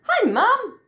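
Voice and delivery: high, loud excited greeting; calling out happily. A narrator's reading. Joey arriving at Wallaby Grove greets Mum, excited. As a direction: call out as the little wallaby.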